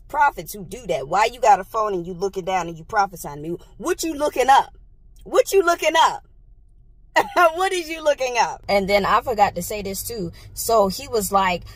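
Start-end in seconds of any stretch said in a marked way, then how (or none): 3.8-8.6 suspicious mocking. Real, not acted. Mocking prophet reading phone.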